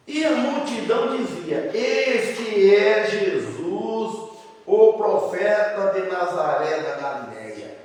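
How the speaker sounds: slow, loud proclamation with stretched words; declaimed scripture reading. Acted. Preacher reading the crowd's words to the church, slow declamation. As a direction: proclaim it like a crowd's announcement, drawn out. Reads scripture aloud to congregation.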